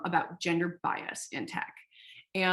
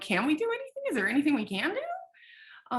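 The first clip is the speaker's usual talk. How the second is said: panicked plea; 8 semitones higher than usual. Retold or imitated. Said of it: "I'm terrified".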